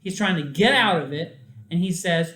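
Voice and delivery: normal talking voice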